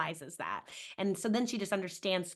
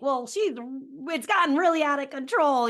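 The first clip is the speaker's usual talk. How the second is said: higher, louder, fed-up parent voice; exasperated parent; 7 semitones higher, 5 dB louder than usual. Retold or imitated. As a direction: play a parent at the end of their rope. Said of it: "a parent might be like".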